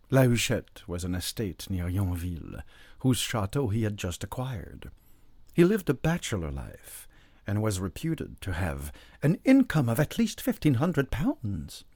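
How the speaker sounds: ordinary delivery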